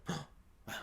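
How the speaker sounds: gasp, then a breathless whisper; barely voiced amazement; hushed awe. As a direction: whisper it in wonder. Acts out a hushed reaction.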